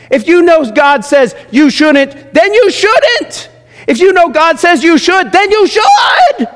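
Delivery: slow, high and hammered, building to a shout; fiery sermon climax, slowed and shouted; rising preacher shout. Real, not acted. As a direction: pound each clause harder until you're yelling. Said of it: "preacher pounding the point".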